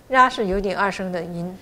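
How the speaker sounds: normal talking voice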